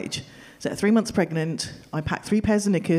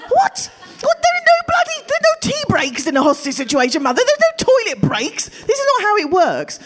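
high, loud, exasperated protest; yelling at mum that hostage situations have no toilet breaks, exasperated; incredulous outburst; 13 semitones higher, 5 dB louder than usual. Retold or imitated. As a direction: shout your disbelief at your mother. Exasperated outburst at her mother.

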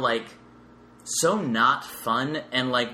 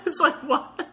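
high, laughing "what"; laughing disbelief; 4 dB louder than usual. Real, not acted. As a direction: laugh through the words in disbelief. Laughing at the skull superstition.

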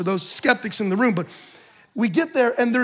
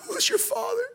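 mock tearful voice, pitched way up and soft; weeping; 13 semitones higher, 6 dB quieter than usual. Biker imitated asking about the Father through tears, weepy. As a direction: imitate a man breaking down, high and small. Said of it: "the guy just begins to weep".

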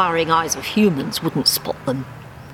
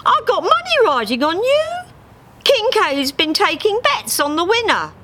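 high cockney duck, pressing; Harry's voice. Acted. Harry explaining her bets to Dave, high and urgent. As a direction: push it like a worried gambler.

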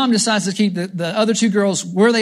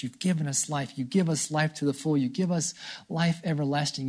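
soft, earnest prayer to God; 3 semitones lower, 6 dB quieter than usual. Acted. Preacher praying before the congregation, soft and earnest. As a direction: quietly pour out thanks in prayer.

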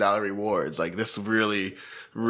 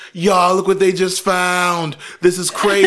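high, loud, slowed gossip thrill; excited livestreamer; 8 semitones higher, 6 dB louder than usual. Retold or imitated. Sister on Facebook Live showing the crime scene, high excited mock. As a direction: hype up the crowd watching your live. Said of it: "my sister's dumbass was, like, on Facebook Live".

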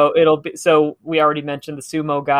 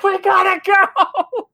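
very high drawn-out mock scream; imitated scream; much slower than usual. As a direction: scream it out long, like two panicking brothers. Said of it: "just start screaming".

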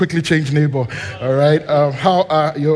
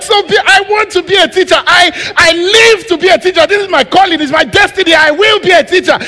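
high, loud hammed-up declaration; mock zealous vow; 13 semitones higher, 7 dB louder than usual. Young dreamer after a movie proclaiming the teaching calling, hammed-up and loud. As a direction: proclaim your destiny with mock fervour.

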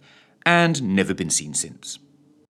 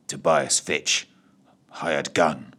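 low gruff voice; 7 semitones lower, slower than usual. Acted. Fitch groggy introduction.

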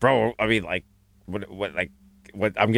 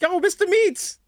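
fan cheer; 14 semitones higher, slower than usual. Pretend. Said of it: "They're like".

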